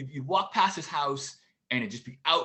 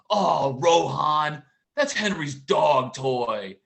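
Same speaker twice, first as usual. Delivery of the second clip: put-on slow, loud little girl's matter-of-fact voice; lightly voiced small daughter, very slow; 4 semitones higher, 8 dB louder, much slower than usual. Daughter explains the toy to her brother, slow and loud. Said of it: "she goes".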